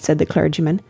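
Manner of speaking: plain reading voice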